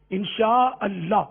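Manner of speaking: انشاءاللہ drawn out, the alif of اللہ clearly sounded; slow model انشاءاللہ, each letter clear; slow careful recitation. Shown as a demonstration. A teacher modelling correct pronunciation, very slow. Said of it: "اللہ کا الف ظاہر ہونا ضروری ہے".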